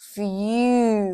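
"feel" stretched slowly as f-yoooo; slow "feel" right down to show the y before oo; drawn-out y glide. Shown as a demonstration. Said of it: "มันจะมีตัวเหมือนยอยักษ์อยู่ข้างหน้าส". (ออกเสียง "feel" ลากยาวเป็น ฟ-ยู)

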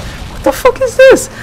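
very high, slow put-on mom voice; imitating mom. Son imitating his mom coming home annoyed, very high. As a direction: squeak it out like an outraged mother. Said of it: "She's like".

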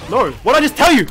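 loud, high burst of reproach; exasperated scolding. Real, not acted. Player telling off an opponent who ignored the warning, exasperated shout.

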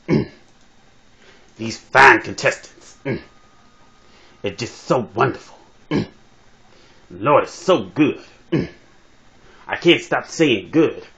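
halting sermon with hummed "mm" after phrases; hammed-up church preacher with long pauses; comic preacher. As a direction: preach it in short bursts, humming between them. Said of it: "talk like this brother being a preacher".

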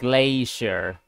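"glacier" as GLAY-shur, the American way; long ay and a sh sound in "glacier"; American pronunciation. Shown as a demonstration. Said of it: "in the American pronunciation".

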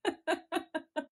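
high giggle at herself; embarrassed laugh. Real, not acted. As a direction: laugh off a failed intro. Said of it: "I can't get good at intros".

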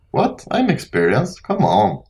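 jumps way up in pitch, disbelieving; a high startled what; surprised. Real, not acted. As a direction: caught off guard, incredulous squeak. Surprised at being called experienced.

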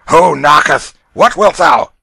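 loud, high gruff call at the door; gruff shout. A narrator's reading. Father woken by raps.

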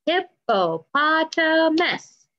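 slow, high, each syllable apart. Shown as a demonstration. Teacher to kids, counting syllables, slow and spaced. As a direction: spell out hip-po-pot-a-mus slowly. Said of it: "we better try that again".

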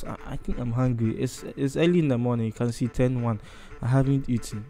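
ordinary talk

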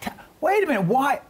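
startled objection breaking in; surprised interruption. Real, not acted. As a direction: stop her in disbelief. Host interrupts, surprised by texting.

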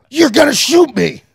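incredulous shout. Retold or imitated. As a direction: yell it at the guard in disbelief. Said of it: "confronting guard".

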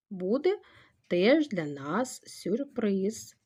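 ordinary talk, nothing marked

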